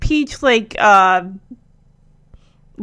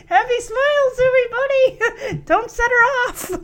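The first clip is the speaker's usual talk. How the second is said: high, laughing mock cheer; fake nervous smile; 10 semitones higher than usual. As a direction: imitate a dad talking through a pasted-on smile. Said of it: "fake nervous smile plastered on his face".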